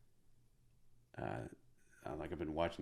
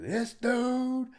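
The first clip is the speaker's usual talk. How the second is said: drawn-out ring-announcer shout, high and loud; Bruce Buffer; 8 dB louder, much slower than usual. Retold or imitated. Heckler announces the verdict to the room, booming shout. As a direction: announce it like a title fight. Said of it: "like, Bruce Buffer".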